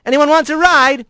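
high, cheerful call over the shoulder. Acted. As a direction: call back to the whole crew. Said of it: "he called over his shoulder".

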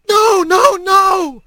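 high, drawn-out shrieks of a hawk; shrieking. A narrator's reading. Hawk fighting off Rat clawing into him, shrieking. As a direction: shriek it in desperate protest. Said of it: "he could hear the bird shrieking".

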